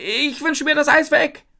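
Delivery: high, loud, desperate shout; screaming. A narrator's reading. Isabel wishes the ice mountain away, screaming. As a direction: yell the wish out urgently. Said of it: "schreie ich".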